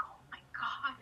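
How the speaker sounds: whispered. Retold or imitated. Awed whisper to self.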